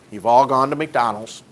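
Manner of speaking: usual speaking voice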